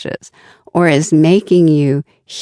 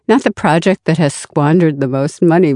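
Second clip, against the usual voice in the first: ordinary talk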